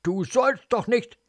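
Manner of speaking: appalled reprimand; horrified angry scolding. Acted. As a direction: burst in and scold in disgust. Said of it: "Ekel, Zorn".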